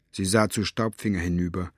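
ordinary delivery, nothing marked

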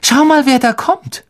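plain reading voice

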